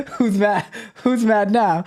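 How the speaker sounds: amused, half-laughing delivery; laughing read. Real, not acted. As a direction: read the taunt while cracking up. Reading chat taunt aloud, laughing.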